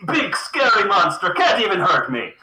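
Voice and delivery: mocking taunt. Pigeon taunts monster while juggling.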